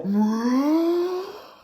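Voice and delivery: loud, long sucking gasp; huge inhale. Acted. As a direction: suck the air in hard. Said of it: "sucked in as hard as he could".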